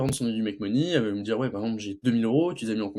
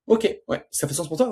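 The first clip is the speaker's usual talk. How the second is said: mock salesman voice; 6 semitones higher than usual. Coach acts out seller's call.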